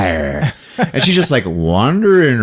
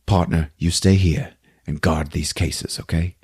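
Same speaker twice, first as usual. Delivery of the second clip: low gruff order; 6 semitones lower, 5 dB quieter than usual. Retold or imitated. Marcus gives Lucy orders.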